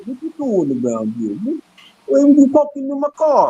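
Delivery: hammed-up New York Italian accent; Rocky. Speaker mocking accents he doesn't have, hammed-up New York Italian. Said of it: "That sound like Rocky or something".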